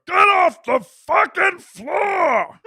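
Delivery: high, slow, garbled angry shout; mock tongueless yell, drawn out; Uncle Rick. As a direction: bellow it with half a tongue. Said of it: "He would talk like this".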